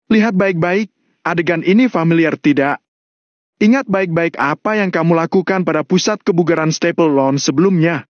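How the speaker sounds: taunting Valentino. Acted. Valentino makes John watch the wreckage, taunting.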